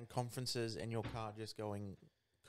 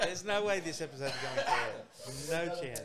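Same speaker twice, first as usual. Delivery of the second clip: laughing disbelief; 4 semitones higher, 8 dB louder than usual. Real, not acted. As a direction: call it out while cracking up. Cracking up at co-host's remark.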